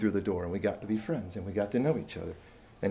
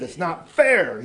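put-on loud, high, drawn-out boyish complaint; frustrated protest; 6 semitones higher, 8 dB louder, much slower than usual. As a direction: imitate an upset boy protesting unfairness. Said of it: "he was frustrated".

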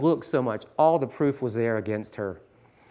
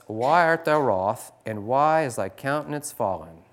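louder formal Bible reading; scripture reading; 2 semitones higher, 6 dB louder than usual. Acted. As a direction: read the old verses with weight. Reads King James scripture aloud.

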